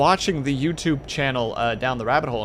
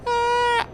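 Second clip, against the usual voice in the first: high yelp; 3 dB louder than usual. Real, not acted.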